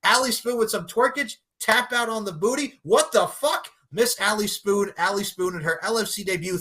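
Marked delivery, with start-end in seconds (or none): 2.8-3.7 high surprised outburst; stunned. Real, not acted. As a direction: blurt it out in shock. Stunned at a quick finish.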